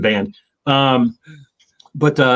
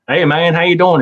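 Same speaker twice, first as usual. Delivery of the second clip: put-on North Carolina accent; 6 semitones higher, 6 dB louder than usual. Imitating Wednesday's greeting in North Carolina accent. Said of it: "he's got a North Carolina accent".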